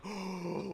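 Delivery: acted sharp intake of breath; shocked gasp. Storyteller reacts to the star's outburst, mock shocked gasp.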